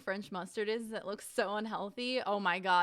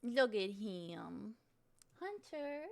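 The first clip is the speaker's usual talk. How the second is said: cooing; much slower than usual. Real, not acted. Streamer gushing over her dog on camera, slow coo. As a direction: melt over him, drawn out.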